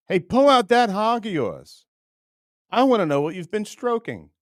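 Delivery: high, loud taunting voice; mock macho challenge. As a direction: dare him loudly in a mock voice. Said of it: "I just wanna say to him".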